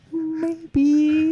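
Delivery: high-pitched shy little voice; drawn-out, timid squeak acted out. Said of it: "Like you're shy? ... Yeah, it's like".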